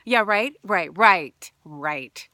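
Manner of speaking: loud scoffing yeah right, right; mock the promise with loud scorn; the trauma part scoffing, loud sarcastic yeah right; sarcastic disbelief. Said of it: "the trauma part was like".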